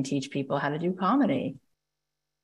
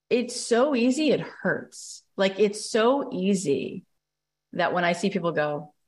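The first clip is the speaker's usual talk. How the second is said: ordinary talk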